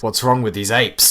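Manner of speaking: exasperated outburst. Acted. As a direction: burst out in disbelief. Exclaimed louder.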